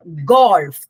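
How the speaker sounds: Golf with a loud, stressed ഗോ; stress on first sound. Shown as a demonstration. Teacher shows students where stress goes, stressing ഗോ in golf. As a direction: punch the ഗോ at the start of golf. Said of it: "ആ ഗോന്റെ അവിടെ stress കൊടുത്ത്".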